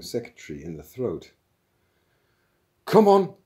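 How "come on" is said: high, loud impatient yell; shout. A narrator's reading. Older intruder pushing past staff, shouting. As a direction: shout to force a way through. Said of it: "shouted the older one".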